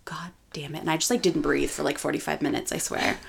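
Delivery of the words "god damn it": breathless whispered curse. Retold or imitated. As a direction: whisper it in awe. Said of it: "I was like".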